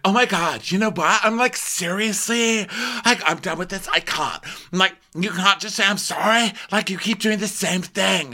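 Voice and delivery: high-pitched exasperated rant; mock pissed-off. Retelling Lisa getting pissed at her friend, high exasperated rant. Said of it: "Lisa gets pissed and she's like".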